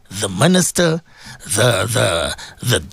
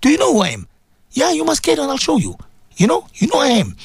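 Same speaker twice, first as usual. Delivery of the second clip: mock quarrelsome challenge, pitched up; upset threat; 9 semitones higher than usual. Preacher acting out people in a small misunderstanding, high-pitched threatening. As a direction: square up to someone in a higher voice. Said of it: "when they get upset".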